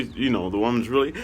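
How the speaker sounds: normal talking voice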